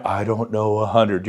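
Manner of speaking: low, soft gloomy whine; a hint of sad donkey; mopey new agent excuse; Eeyore mope. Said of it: "You're going all Eeyore on me".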